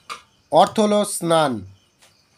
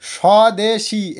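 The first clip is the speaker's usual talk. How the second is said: Swadeshi drawn out very slowly and clearly; teacher modelling swadeshi slowly for the class; slow careful example; 2 semitones higher, much slower than usual. Shown as a demonstration. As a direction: say swadeshi slowly so pupils hear each part. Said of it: "এটা ভালো করে বোঝো".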